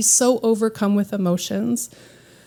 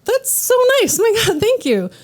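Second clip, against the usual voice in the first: much higher, bubbly flattered voice; gushing thanks; 10 semitones higher than usual. Retold or imitated. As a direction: squeal the thanks like you're charmed. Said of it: "I was like".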